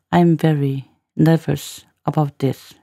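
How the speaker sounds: slow reading with gaps around "nervous"; measured teacher's repeat, piece by piece; slow, chunked demo. Shown as a demonstration. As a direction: spell out each phrase for learners.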